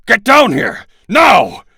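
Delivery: loud, high yell up the stairs; yelling upstairs. Acted. Chapman yells upstairs to his wife, loud. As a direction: bellow it up to the next floor. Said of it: "he yelled upstairs".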